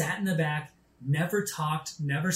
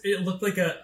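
usual speaking voice

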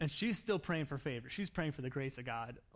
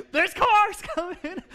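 high, loud, slow panicked yelp; panicked cry; 15 dB louder, slower than usual. Retold or imitated. Passenger yelling at driving friend, panicked mock cry. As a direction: cry out in mock terror at oncoming cars.

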